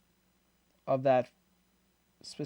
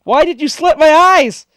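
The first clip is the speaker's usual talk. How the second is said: high, loud outraged wail; mock screaming; 10 semitones higher, 11 dB louder than usual. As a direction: imitate the hero's furious screaming. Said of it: "he was screaming like a bitch".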